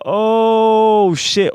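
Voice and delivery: high, loud drawn-out cry of dismay; reenacted freak-out, stretched and shouted; panicked exclamation. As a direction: wail it in mounting panic. Retold panic when tooth broke.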